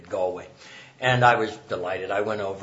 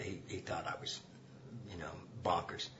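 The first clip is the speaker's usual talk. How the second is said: whispered; 4 semitones lower, 15 dB quieter than usual. Retold or imitated. Aside about father's view.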